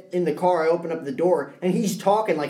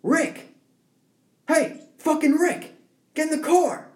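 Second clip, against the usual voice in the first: impatient driver calling; 11 semitones higher than usual. Retold or imitated. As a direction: holler at a stranger like your buddy. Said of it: "He was just like".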